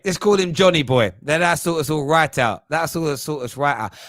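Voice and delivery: ordinary delivery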